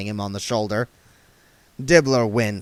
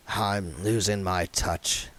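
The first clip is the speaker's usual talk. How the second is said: quiet, slow, rueful sigh of a line; a touch of gloom, softer and slower; sad; 4 semitones lower, 6 dB quieter, slower than usual. Acted. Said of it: "Dibbler shook his head sadly".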